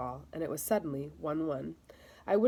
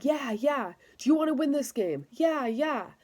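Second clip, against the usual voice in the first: higher, louder excited yells; whole team hollering back; team yelling; 7 semitones higher, 4 dB louder than usual. Acted. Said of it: "we started yelling".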